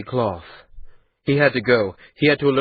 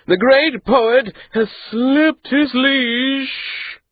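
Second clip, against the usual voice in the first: higher, slow, mocking, barely holding a laugh; laughter in his voice; 14 semitones higher, 4 dB louder, much slower than usual. The andat mocking Maati, laughter in his voice. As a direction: laugh at the boy as you say it. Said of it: "laughter in his voice".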